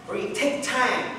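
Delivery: ordinary talk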